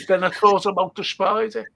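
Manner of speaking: normal talking voice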